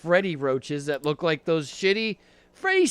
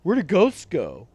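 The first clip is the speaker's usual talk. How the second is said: mock-dumb questioning, ending loud; imitating a friend's dopey wondering; impression of friend; 5 dB louder than usual. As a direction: ape a friend's silly question. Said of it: "That's a great impression of me".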